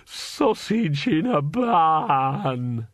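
slow, bawling wail; slowly bawled sausage in a bun. A narrator's reading. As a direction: wail it through tears. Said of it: "it bawled".

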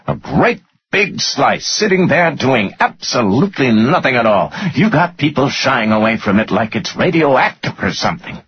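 high-pitched exasperated rant; angry tirade. A narrator's reading. Finance guy berating his boss over stock, ranting angrily. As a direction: rant at him in worked-up exasperation.

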